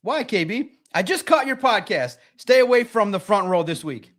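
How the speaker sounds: ordinary talk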